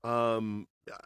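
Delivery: ordinary delivery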